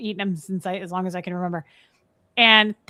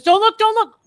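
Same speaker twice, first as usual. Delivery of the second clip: high, loud repeated alarm; retold panicked yelp; frantic warning; 13 semitones higher, 9 dB louder than usual. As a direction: act out stopping someone urgently.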